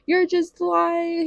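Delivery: high, loud, drawn-out protest; exasperated accusation. Retold or imitated. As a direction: act out calling his bluff mid-labor. Retells accusing partner during labor.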